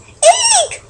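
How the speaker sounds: high startled eek; mother bird's piercing squeal; cried shriek. Acted. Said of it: "she cried".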